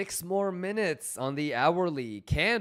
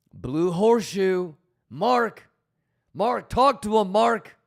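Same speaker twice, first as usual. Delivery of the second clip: louder, calling "Mark, talk to him"; excited call-out; 3 semitones higher, 7 dB louder than usual. Pretend. Streamer shouting a movie quote at a viewer, excited. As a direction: hype up a friend with a movie line.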